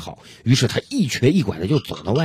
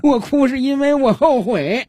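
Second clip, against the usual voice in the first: high, slow, hammed-up wail; mock crying; 13 semitones higher, 3 dB louder, slower than usual. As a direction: bawl out your regret in a put-on high voice. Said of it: "就坐在路边哭". (拖长的高音假哭腔)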